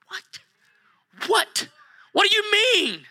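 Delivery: shouted, very high what; astonished. Acted. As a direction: burst out in disbelief. Mock astonished listener reaction.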